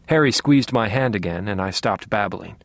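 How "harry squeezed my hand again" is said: ordinary delivery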